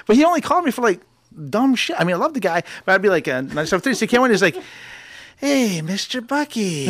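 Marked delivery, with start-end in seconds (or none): 5.4-6.9 put-on old hippie dad. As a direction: lightly voice his laid-back father. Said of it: "He talked like an old hippie".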